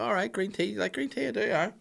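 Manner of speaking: high, soft voices of friends chatting; mock café conversation in a high voice; put-on friendly chit-chat. Acting out friends chatting over tea.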